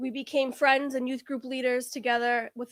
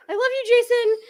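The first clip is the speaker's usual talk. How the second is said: high, loud, put-on declaration; blurted confession; 10 semitones higher, 8 dB louder than usual. Woman made to tell Jason, retold high blurt.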